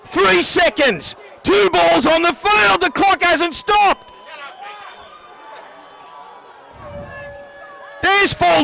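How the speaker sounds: high, excited, shouted final-seconds call; live play-by-play. Real, not acted. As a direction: call the last seconds at full voice.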